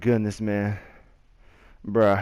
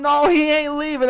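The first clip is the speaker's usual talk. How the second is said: mock disbelief; 13 semitones higher than usual.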